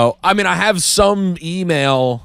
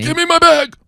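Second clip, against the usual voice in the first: high, loud, exasperated demand; frustrated shout; 6 dB louder than usual. Retold or imitated. Answering the airline survey, shouting high and loud for his bag.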